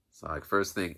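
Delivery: ordinary delivery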